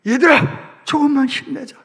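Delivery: loud, high-pitched cheer to hold on; rally the young soldiers to keep going; rallying call. Acted. Sailor calling loudly to his sinking comrades.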